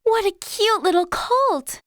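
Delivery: high, gushing delight; thrilled and smitten, voice lifted high; delighted surprise. Acted. Receives a colt as surprise gift.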